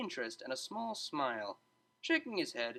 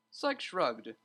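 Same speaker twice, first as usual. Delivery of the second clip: usual speaking voice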